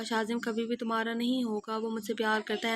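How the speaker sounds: ordinary delivery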